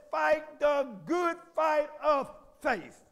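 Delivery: very slow and loud; slow emphatic quote. Shown as a demonstration. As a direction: spell out each word of the verse. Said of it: "The Bible says".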